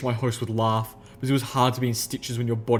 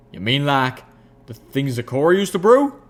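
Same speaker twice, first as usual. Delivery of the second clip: wary country mare; 3 semitones higher, 6 dB louder than usual. Acted. Applejack asking about Zecora's brews, loud wary voice. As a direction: ask warily, pitched up.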